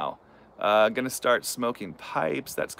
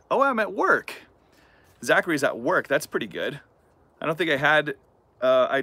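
muffled behind fingers; speaking through cupped hand; hand over mouth; 3 semitones higher than usual. Real, not acted. Seen 0: hand covers mouth at 0.1–0.3, lowered to chin by 0.6.

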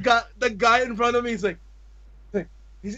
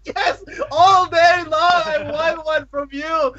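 mock happy crying; 7 semitones higher, slower than usual. Overjoyed fan telling idol.

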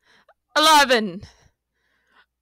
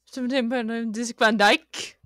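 ordinary talk

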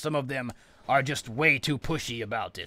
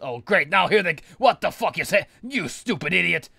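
loud, higher angry yell; exasperated outburst; 5 semitones higher, 6 dB louder than usual. Real, not acted. Player reacting to his helper's blunder, exasperated outburst. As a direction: burst out in disbelief.

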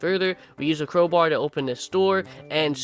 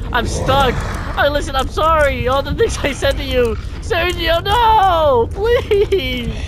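high, loud, wailing begs; mock-tearful pleading; 10 semitones higher, 4 dB louder, slower than usual. Stuck player begs the villain to come back, mock-crying pleas. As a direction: wail after them as if being abandoned, hammed-up. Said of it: "I'm literally crying".

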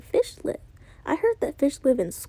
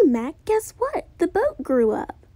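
ordinary delivery, nothing marked

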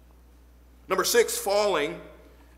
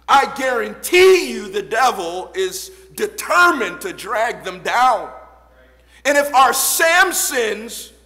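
loud, higher preaching build; preaching at full force; 5 semitones higher, 10 dB louder than usual. Acted. Preacher warning the church about the devil, loud. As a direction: drive it home to the pews.

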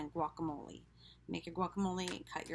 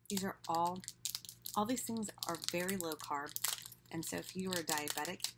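usual speaking voice

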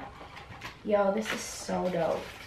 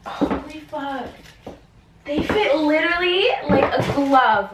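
loud, slow, high thrilled gush with a laugh; louder, higher, slow laughing excitement; overjoyed; 6 semitones higher, 10 dB louder than usual. Real, not acted. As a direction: gush it, can't contain the joy. Shoes fit perfectly.

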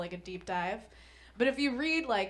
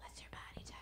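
even softer creepy whisper; menacing whisper; 17 dB quieter, faster than usual. Retold or imitated. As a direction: breathe it like a threat. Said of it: "it's like a threat".

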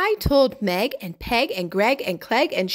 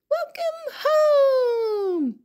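overjoyed; much slower than usual. Acted. Egg returns to friends.

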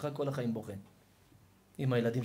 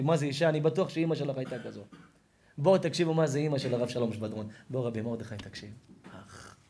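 normal talking voice

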